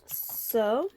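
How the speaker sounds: soft, breathy ASMR murmur. Shown as a demonstration. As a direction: ease into a gentle ASMR hush. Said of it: "little A S M R voice".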